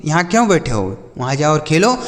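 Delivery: normal talking voice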